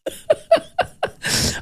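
breathy high giggle; laugh. Real, not acted. Laughs at own remark.

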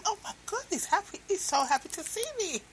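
high, hushed and quick, thrilled; excited wife. Acted. Wife overjoyed that her husband is happy to see her, excited. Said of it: "being excited".

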